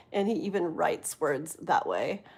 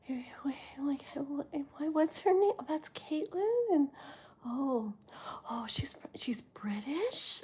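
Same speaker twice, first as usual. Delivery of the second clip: hushed, excited whisper; 6 semitones higher, 8 dB quieter than usual. Retold or imitated. Her son asking about Caitlin, in an excited whisper. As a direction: whisper it like an awestruck kid. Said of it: "he was just like".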